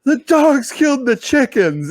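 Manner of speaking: high, slow, upset announcement; wife coming in to report the dogs killed the chickens. Retold or imitated. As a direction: break the bad news, imitating wife.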